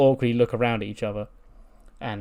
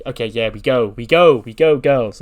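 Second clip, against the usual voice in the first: rallying call; 10 dB louder than usual. Retold or imitated. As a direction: shout the girls into moving. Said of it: "Mackie's like".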